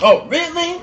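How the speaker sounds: high, mock-offended voice; imagined offended reaction. Imagines how he would react to a no, mock offence. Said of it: "imagine how he react".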